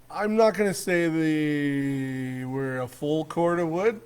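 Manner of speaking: ordinary delivery, nothing marked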